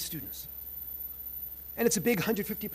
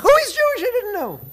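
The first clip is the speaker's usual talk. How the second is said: much higher, louder startled squeal; students' surprise; 13 dB louder than usual. Retold or imitated. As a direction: blurt it out in shocked delight. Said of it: "I go".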